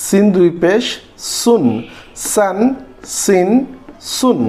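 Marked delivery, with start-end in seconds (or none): none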